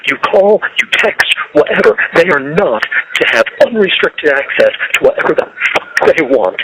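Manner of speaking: slow, seething emphasis; slow bitter rant on the phone; angry. Real, not acted. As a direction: spit out each word in anger. Angry jail call rant.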